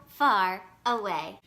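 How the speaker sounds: plain reading voice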